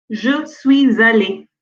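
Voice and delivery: Je suis allé with a z, sui-z-allé; liaison demo. Shown as a demonstration. Teacher slowly modeling liaison in Je suis allé. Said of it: "on dit".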